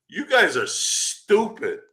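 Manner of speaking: drawn-out taunt at gullible fans; mocking. Taunting the fans who bought the rumour, slow mockery. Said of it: "I go".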